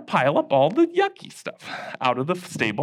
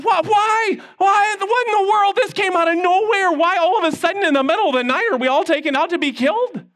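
shocked Daniel; 11 semitones higher than usual. Acted. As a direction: sound stunned and bewildered. Daniel shocked by death sentence.

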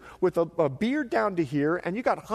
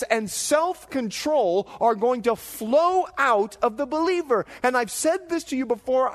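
louder, higher, slow fervent listing; preaching at full force; 7 semitones higher, 4 dB louder, slower than usual. Acted. Preacher to congregation listing fruits of the Spirit, fervent. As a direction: ring out each fruit of the Spirit.

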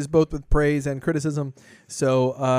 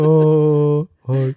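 bedroom voice; 8 dB louder than usual. Pretend. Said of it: "This is my bedroom voice".

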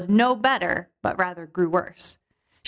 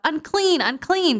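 calling out; 7 semitones higher, slower than usual. Pretend. Outcast woman warning the crowd, high calling cry. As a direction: call out to keep people away. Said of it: "you have to yell out".

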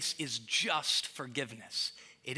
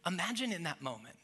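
normal talking voice

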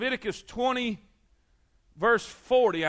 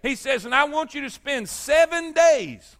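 high, raised preacher's voice; emphatic pulpit delivery, hammering repeated phrases; impassioned preaching; 6 semitones higher, 5 dB louder than usual. Acted. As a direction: proclaim God's instructions with rising force.

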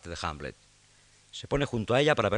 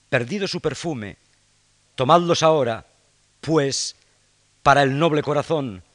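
impassioned pleading; 3 semitones higher, 7 dB louder than usual. Acted. Ophelia pleads returning gifts.

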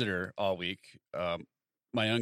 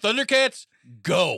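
high, loud cartoon rallying call; mock battle cry; 7 semitones higher, 7 dB louder, slower than usual. Co-host riffing on the tic, mock battle cry. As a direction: shout it like a cartoon hero.

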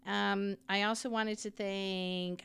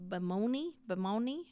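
normal talking voice

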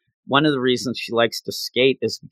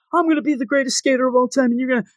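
mock yell; 9 semitones higher, much faster than usual. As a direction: imitate someone yelling a brag, pitched way up. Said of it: "like, yell".

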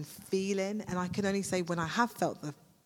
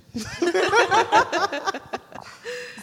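a burst of high, loud laughter; 14 semitones higher, 10 dB louder than usual. Real, not acted. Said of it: "I just laughed".

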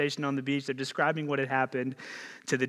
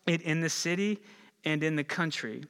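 ordinary delivery

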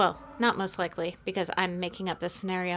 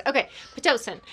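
ordinary talk

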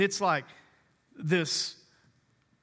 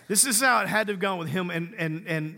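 usual speaking voice

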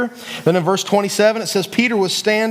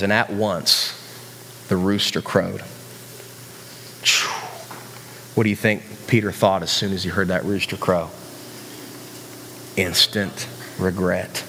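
grave, low narration, letting each phrase land; solemn storytelling; 11 semitones lower, 6 dB quieter than usual. Real, not acted. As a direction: drop right down for the grave moment.

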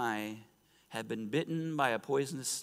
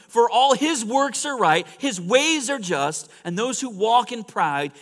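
loud, high declamatory scripture reading; full-force preaching; 8 semitones higher, 10 dB louder than usual. Acted. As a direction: proclaim the verse to a whole congregation.

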